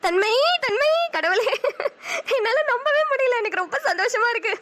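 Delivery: high, laughing through tears; overjoyed. Acted. Mother-to-be alone with the news, laughing and crying. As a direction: laugh and cry with joy at once.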